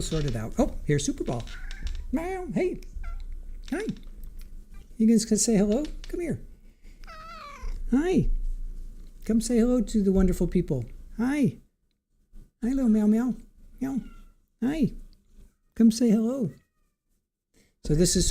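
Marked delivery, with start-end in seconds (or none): 2.1-2.5 high squeaky meow to the cat. Acted. Owner mewing at her cat. 2.5-16.5 high, soft pet voice. Real, not acted. Owner cooing to her cats on stream, high baby talk.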